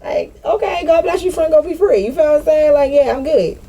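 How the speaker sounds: high, sing-song, breezily dismissive; mock sweet farewell. Brushing off someone she cut off, high sing-song. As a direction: wave them off with syrupy cheer.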